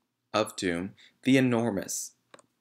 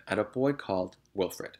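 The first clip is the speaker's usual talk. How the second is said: plain reading voice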